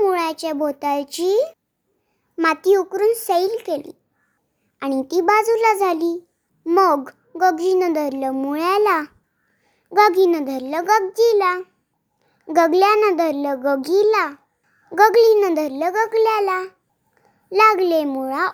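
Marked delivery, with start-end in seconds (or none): none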